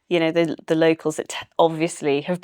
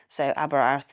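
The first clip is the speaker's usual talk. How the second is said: usual speaking voice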